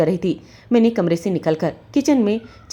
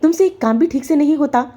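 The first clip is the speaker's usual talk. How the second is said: high, raised furious yell; angry yell; 8 semitones higher than usual. Acted. Husband yelling at wife over ruined shirt, furious. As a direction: shout the insult at her. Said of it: "जोर से चिल्लाए".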